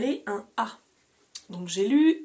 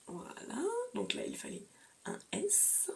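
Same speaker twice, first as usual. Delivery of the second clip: whisper; 8 semitones higher, 12 dB quieter than usual. Real, not acted. Whispered aside while showing books.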